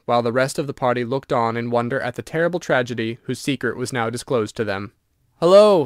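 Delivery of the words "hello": loud, high surprised shout; sudden exclamation. A narrator's reading. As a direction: call out in surprise. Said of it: "suddenly exclaimed one of the twins".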